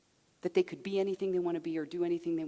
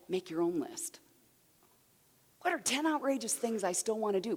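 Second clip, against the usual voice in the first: ordinary talk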